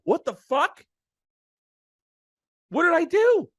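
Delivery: high, loud incredulous cry; mock outraged disbelief. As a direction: play the fired actor, stunned and indignant. Retold getting fired, outraged.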